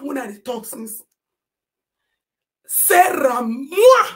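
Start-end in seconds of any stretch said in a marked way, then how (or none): 2.7-4.1 higher, louder wail; anguished wail; wailing cry. Real, not acted. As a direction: cry out her name. Crying out own name.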